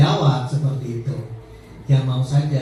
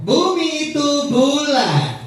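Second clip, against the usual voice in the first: slow clue reading; 8 semitones higher, much slower than usual. Shown as a demonstration. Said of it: "ice breaking".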